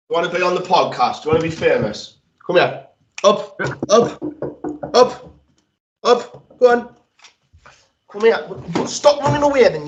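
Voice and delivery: sing-song coax to get the dog up. Real, not acted. Owner calling his growling dog onto his lap, high coaxing.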